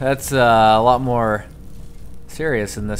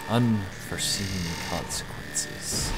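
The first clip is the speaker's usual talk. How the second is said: ominous voice; 6 semitones lower, 10 dB quieter, slower than usual. Retold or imitated. Echoing game scientist line.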